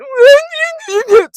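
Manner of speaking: loud high-pitched laugh; a shrieking laugh; high burst of laughter. Real, not acted. Laughing at what Kenny did, high shriek.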